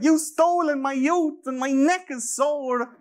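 put-on high, slow, squeaky parody of a teen activist; mock Greta voice. Mocking Greta to his podcast friends, in a high slow squeak. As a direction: lampoon her with a high, slow quavering voice. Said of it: "she goes out and she's like".

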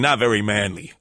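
normal talking voice

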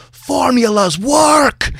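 high, slow Scottish shout; Scottish brogue yell. Retold or imitated. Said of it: "in thick Scottish brogue, Mike Myers yells".

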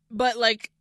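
ordinary delivery

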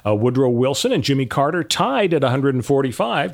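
normal talking voice

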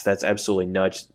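normal talking voice

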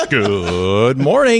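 long, drawn-out hammed-up morning greeting; Greeny. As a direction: stretch it out like a radio host. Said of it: "I could be Greeny".

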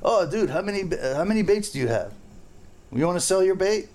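high-pitched, nosy questioning in mimicry. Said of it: "it's all about probing you".

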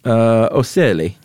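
ocelli with a mock Italian lilt; Italian accent. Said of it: "What are they known as in your Italian accent?".

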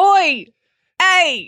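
high New Zealand-style call; hammed-up New Zealand holler; New Zealand accent attempt. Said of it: "do a New Zealand accent".

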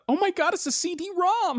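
high, loud mock wonder at old tech; mock amazed gasp. Friend mocks Jurassic Park scientist, high mock amazement. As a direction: squeal it like a dazzled movie scientist. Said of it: "she talks about".

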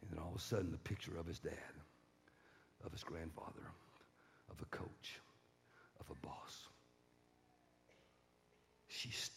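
low, soft, spaced-out narration; quiet, solemn preacher's voice; hushed sermon storytelling. A narrator's reading. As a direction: let each phrase fall gravely.

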